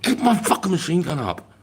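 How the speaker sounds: high, sharp retold order; a mock section commander yelling up a ridge; retold yell at recruit. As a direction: bark it up the ridge at a straggler.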